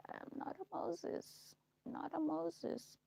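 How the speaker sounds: slow, whispered, half to herself; hushed denial. Real, not acted. To her viewers, a slow whispered denial. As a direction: barely breathe the words out. Repeats denial, dropping to a hush.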